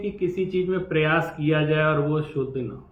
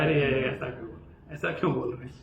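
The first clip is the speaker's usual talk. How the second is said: pained dismay; 3 semitones lower, 7 dB quieter, faster than usual. Retold or imitated. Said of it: "थोड़ा दुख होता है".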